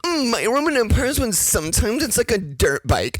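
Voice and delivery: high, lilting mock Valley Girl. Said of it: "Valley Girl talking about farts".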